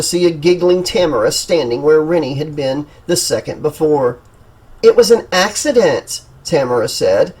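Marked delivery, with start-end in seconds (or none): 4.8-6.2 insincere. Acted. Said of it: "her expression saying it was anything but".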